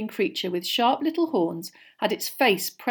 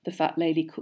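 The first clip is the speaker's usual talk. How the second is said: plain reading voice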